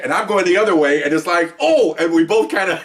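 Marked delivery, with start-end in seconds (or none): 1.6-2.0 high pained yelp, imitated; high mock groan. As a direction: squeak out a pained oh. Imitating crack of spine.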